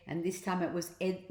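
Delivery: plain reading voice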